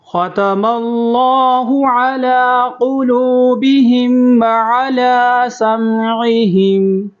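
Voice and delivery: slow, high melodic tajweed chant; Quran recitation. Shown as a demonstration. Teacher recites for students to follow, slow melodic chant. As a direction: recite it slowly with a drawn-out melody.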